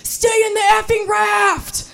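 loud, very high, slow angry yell; furious shout. Retold or imitated. To husband climbing out of the raft, furious high shout. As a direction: yell at him to stay put. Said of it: "I'd say".

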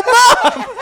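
very high, loud squeal of disbelief; exasperated cry. Retold or imitated. Son crying out at gullible mom, exasperated. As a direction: cry out in comic despair. Retold outcry at mom's gullibility.

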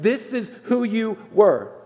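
slow, pressing refrain; slow weighted refrain. Acted. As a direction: hammer the refrain slowly.

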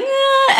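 high, drawn-out "ah"; a sudden high sound while pushing energy out; high exclamation. Retold or imitated. Channeling energy into the room.

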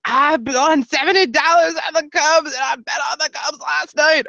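loud, high-pitched bragging yell; imitated yelling fan. Very loud Cubs fan bragging on the train, imitated high yell. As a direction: mimic an obnoxious fan hollering high and loud. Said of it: "who was yelling".

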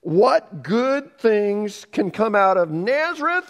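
mock the hometown with a sneer; sneering Nathanael. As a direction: Nathanael dissing Nazareth, high and scornful. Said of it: "You said".

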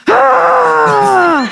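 high, loud panicked husband yell; high mock scream. Husband bursting down the stairs, high mock scream. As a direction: squeal it like a frazzled dad. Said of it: "he's like".

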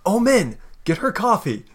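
high called-out order; high yell across the house; yelling back. Acted. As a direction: call it to the front room. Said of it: "She yells back to the front".

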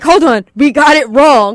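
loud, urgent interruption; retold announcement. Presenters stopping the Oscars to correct a mistake, loud acted interruption. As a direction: act out halting the show.

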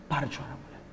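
soft unvoiced hush of comfort; whispered. Preacher whispering Jesus's words to the widow. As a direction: breathe the words like a secret.

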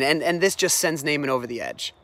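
ordinary talk, nothing marked

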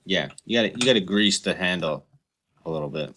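ordinary talk

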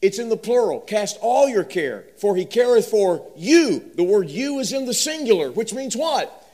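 loud, high emphatic preaching; preaching at full force. Acted. Preacher pressing the verse on the congregation, loud and high. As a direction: drive the point home to the congregation.

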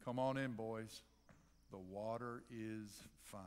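low, soft, easygoing invitation; movie character quote. Acted. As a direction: invite them in, slowing down on the last line. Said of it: "sounds a little bit like, Oh, brother".

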